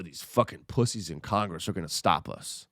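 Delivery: ordinary delivery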